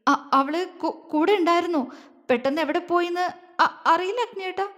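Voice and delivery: high, halting tearful answer; crying, stammering. A narrator's reading. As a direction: stumble through it near tears. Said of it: "Manasi കരഞ്ഞോണ്ട് പറഞ്ഞു".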